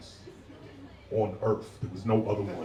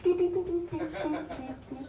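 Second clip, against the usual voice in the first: very high, rapid little footstep noises; imitated stomping; faster than usual. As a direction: mimic stomping off with tiny high "do" sounds. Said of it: "they stomp out the room like".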